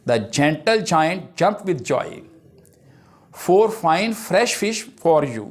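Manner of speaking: very slow, deliberate recitation; measured classroom read-out of tongue twisters; slow example reading. Shown as a demonstration. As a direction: draw out each alliterative word.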